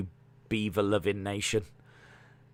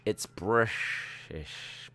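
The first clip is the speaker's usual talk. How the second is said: a mumbled Scottish Bond voice; Sean Connery impression; 8 dB quieter, much slower than usual. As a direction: drawl "British" like Sean Connery. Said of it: "Sound like Sean Connery".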